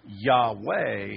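Yahweh in two firm beats; two-syllable pronunciation. Shown as a demonstration. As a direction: demonstrate Yahweh as yah-WAY. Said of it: "would be two syllables".

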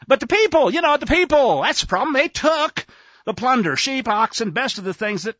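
high, loud mock excuse; blame-shifting protest. Saul blaming the people, loud and defensive. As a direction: pass the blame, insistently.

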